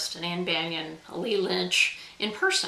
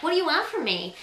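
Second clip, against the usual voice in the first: higher-pitched, whiny complaint; 6 semitones higher than usual. Shown as a demonstration. Author showing viewers the way it is not meant, whiny. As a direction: whine it like a put-upon grumble. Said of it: "in that whiny way".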